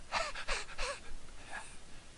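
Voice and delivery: repeated voiceless huffs of H; demonstrating an H with puffs of air; breathy H sound. Shown as a demonstration. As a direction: huff out a softened T as pure breath. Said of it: "to being a".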